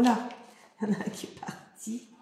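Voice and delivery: fast startled whisper; quick whisper. Real, not acted. Un autocollant tombe. (chuchotement rapide et surpris)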